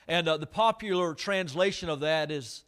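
ordinary talk, nothing marked

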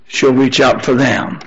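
ordinary talk, nothing marked

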